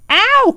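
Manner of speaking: high yelp of pain; the bear yelping as Rabbit tugs; cry of pain. A narrator's reading. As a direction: yelp as your paw is pulled. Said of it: "cried Pooh".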